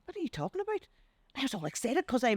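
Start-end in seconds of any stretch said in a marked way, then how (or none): 0.1-0.9 incredulous question. Retold or imitated. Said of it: "And they went".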